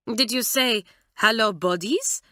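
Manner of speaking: a hint of a French accent, puzzled; lightly voiced French aunt questioning the phrase. A narrator's reading. Mamselle repeating the odd greeting, lightly voiced and puzzled French. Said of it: "said Mamselle".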